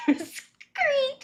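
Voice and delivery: laughs it out, high and slow; cracking up while saying it; laughing. Real, not acted. Viewer laughing at the clip while saying it was great.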